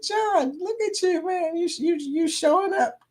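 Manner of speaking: high, teasing praise; mock impressed. Host teasing John for typing the hashtag, mock impressed. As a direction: tease him like a proud buddy. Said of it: "I said".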